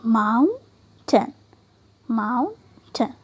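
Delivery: माउंटेन very slowly, maun, pause, ten; two syllables. Shown as a demonstration. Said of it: "इसलिए हमने दो syllable". (माउंटेन को धीरे-धीरे माउन, टेन में तोड़कर)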